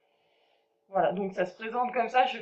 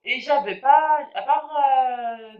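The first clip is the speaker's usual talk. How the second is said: ordinary talk, nothing marked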